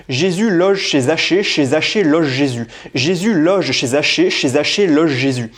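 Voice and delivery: Jésus loge chez Zachée slow and crisp; slow tongue-twister drill on loge and Zachée; careful tongue twister. Shown as a demonstration. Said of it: "je vais t'en faire quelques-unes". (articulation lente de Jésus loge chez Zachée)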